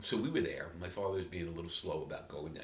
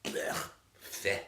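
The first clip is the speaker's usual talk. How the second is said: disgusted scoff; 3 semitones higher, 6 dB louder than usual. Acted. Disgust at Chicago pizza.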